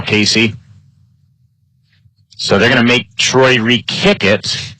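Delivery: normal talking voice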